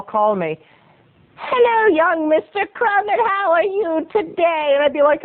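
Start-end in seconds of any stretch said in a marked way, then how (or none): 1.4-4.7 put-on very high-pitched old woman, drawn out; old lady voice. Old neighbor greets shoveling boy, old-lady voice. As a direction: sing out an elderly neighbor's greeting. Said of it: "That's my old voice".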